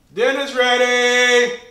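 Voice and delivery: long high shout across the house. Real, not acted. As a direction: holler that dinner's ready. Calling family to dinner.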